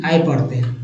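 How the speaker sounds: usual speaking voice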